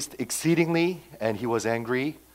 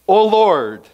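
indignant address; 8 semitones higher, 12 dB louder than usual. Retold or imitated. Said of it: "see how he addresses it?".

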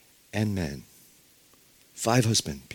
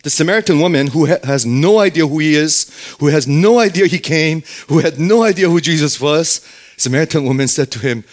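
ordinary delivery

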